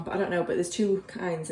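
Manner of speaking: usual speaking voice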